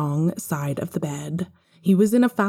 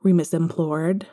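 plain reading voice

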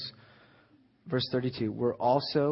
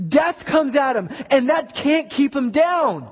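preaching at full force; 9 semitones higher, 6 dB louder than usual. Acted. Pastor rallying the congregation about the cross, full-force preaching. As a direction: pound out the victory over death like a revival preacher.

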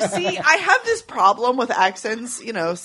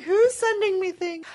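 high, bewildered inner voice; puzzled self-quote; 9 semitones higher than usual. Retold or imitated. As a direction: wonder aloud who is sending these. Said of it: "I was like".

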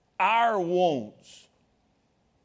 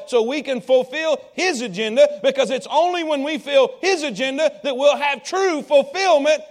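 loud, rising sermon drive; building, loud preacher's climax; preaching at full force; 4 semitones higher, 6 dB louder than usual. Acted. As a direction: push the message home to the congregation.

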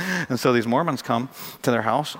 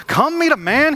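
high, raised, acted excitement; excited announcement; 13 semitones higher, 5 dB louder, slower than usual. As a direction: call them over with big news. Acts woman excitedly telling townsmen.

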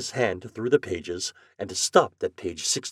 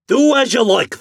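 shouted, much higher and louder; angry shout; 13 dB louder than usual. Acted. Agent Flannery shouting across the counter, angry. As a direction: bellow it at the customer. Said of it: "shouted Flannery".

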